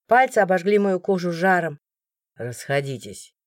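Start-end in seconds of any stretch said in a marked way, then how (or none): 2.4-3.3 low, quiet command; deep, soft-spoken firmness; order, voice unraised. A narrator's reading. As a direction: disperse the crowd with calm authority. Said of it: "не повышая тона, приказал стражник".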